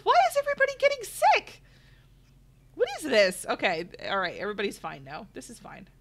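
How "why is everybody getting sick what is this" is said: high, fast mock confusion; baffled. Streamer imitating her earlier confused self. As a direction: replay her own puzzled panic. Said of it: "I was like".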